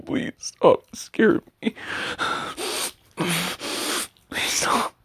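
strained frightened pleading; panicked plea. Acted. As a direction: beg in rising panic. Panicked begging.

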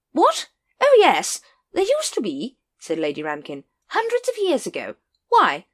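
high, loud, bluff aristocratic lady; brisk and booming; hearty noblewoman. Acted. Lady Ramkin answering Vimes, high and hearty.

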